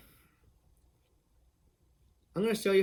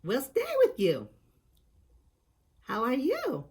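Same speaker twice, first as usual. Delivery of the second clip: fairy twins voice; 7 semitones higher than usual. Shown as a demonstration. Demonstrating movie lines in a high fairy voice. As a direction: speak like tiny movie fairies. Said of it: "they say words like".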